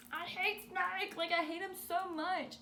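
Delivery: high, slow, loud whine; dramatic high whine. Retold or imitated. Texting Race about hating Snake, high whiny rant. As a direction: vent it like a wounded teen.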